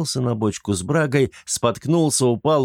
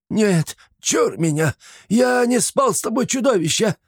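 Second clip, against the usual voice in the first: panicked protest; 12 semitones higher than usual. A narrator's reading. The old man denies it to the monster, panicked. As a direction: fend the monster off in terror.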